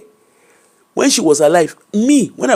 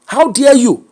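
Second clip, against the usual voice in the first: higher-pitched, indignant challenge; recalled outrage, pitched up; retold anger; 6 semitones higher, slower than usual. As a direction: re-enact confronting your mother in anger. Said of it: "I was angry".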